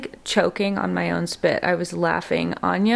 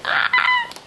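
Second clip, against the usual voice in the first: surprised yelp. Real, not acted. Wig cap snapping over head.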